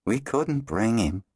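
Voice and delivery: slow, regretful; regretfully. A narrator's reading. Zist explaining to Kayla why the boy stays, regretful. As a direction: let the regret slow the words. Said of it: "Zist said regretfully".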